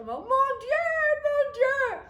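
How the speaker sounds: very high, loud astonished cry; family hears the engagement news, shrieking in astonishment; French exclamation. Retold or imitated. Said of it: "my French go".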